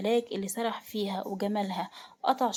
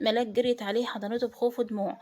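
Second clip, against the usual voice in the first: ordinary delivery, nothing marked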